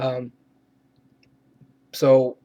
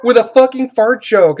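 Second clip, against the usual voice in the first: exasperated rant; 6 semitones higher, 6 dB louder than usual. Real, not acted. Ranting about unfunny movie.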